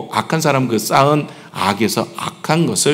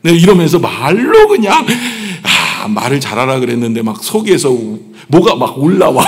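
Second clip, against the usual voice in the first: ordinary talk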